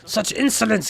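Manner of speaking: mock indignation. [name] offended by crew.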